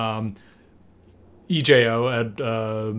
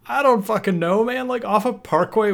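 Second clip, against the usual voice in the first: higher-pitched mock exasperation; exasperated protest; 10 semitones higher than usual. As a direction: protest like a put-upon friend on the phone. Acted exasperated reply on phone.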